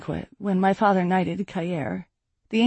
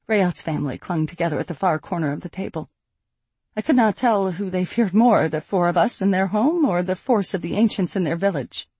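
ordinary delivery

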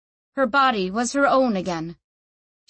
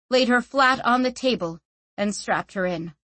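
plain reading voice

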